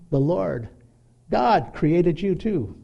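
normal talking voice

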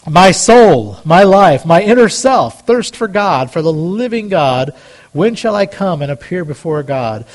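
loud preacher's psalm reading; preaching scripture. Acted. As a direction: proclaim the verse to the congregation. Reads psalm with full force.

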